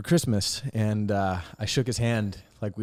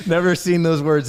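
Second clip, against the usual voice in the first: laughing; 7 semitones higher, 7 dB louder than usual. Real, not acted. Laughing about grandpa.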